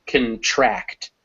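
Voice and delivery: "contract" with schwa in con, stress on TRACT. Shown as a demonstration. Teacher explains the verb, end-stressed "contract". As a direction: say "contract" deliberately, weak start and strong end. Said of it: "the stress shifts to the end".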